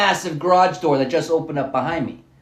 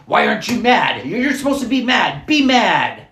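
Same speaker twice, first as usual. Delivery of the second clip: higher, louder, demanding they be mad; mock demanding anger; 8 semitones higher, 6 dB louder than usual. People demanding you stay angry, loud mock outrage. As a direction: push them to be angry, accusing. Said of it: "but it's like".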